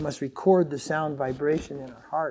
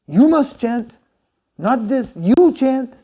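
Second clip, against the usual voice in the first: retold angry order, much higher and louder; angry command; 8 semitones higher, 11 dB louder, slower than usual. Guru angrily ordering devotees to chant instead of recording, loud scolding. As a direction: scold them into chanting, sharp and forceful. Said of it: "like, angry".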